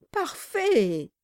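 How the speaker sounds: high eager exclamation; fawning cry. Acted. The lawyer flatters Quilp, a high fawning cry. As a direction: gush approval. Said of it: "cria-t-il".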